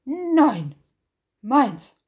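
Nein and meins barked very loud, falling; bear's loud shout. Acted. Bär shouts back at Hase, very loud. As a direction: slam down each word, loud. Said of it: "rief Bär".

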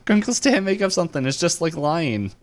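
high-pitched cartoon girl quote; excited kid character. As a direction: imitate an eager niece, pitched well up. Voicing Mabel urging her uncle.

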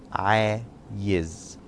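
"عايز" said slowly, stretched out as aayiz; "عايز" ko dheere, kheench kar aayiz bolo; slow male form. Shown as a demonstration. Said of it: "If you're a male".

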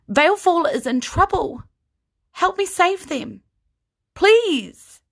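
high, loud plea ending in a cry; pleading. Acted. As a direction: beg with all you have left. Kyra pleads with the crowd.

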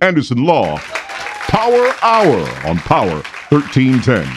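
big slow announcer voice; radio announcer. Acted. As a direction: sell the station name. Station ID announcer flourish.

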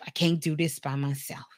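breathy whisper of defeat. Acted. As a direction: whisper it as a private admission. Mama admits she needs help.

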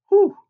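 high, loud exhaled whew; amazed whew. Acted. Preacher imagining power leaving Jesus for ten healings, amazed whew. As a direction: blow out a short, high "whew".

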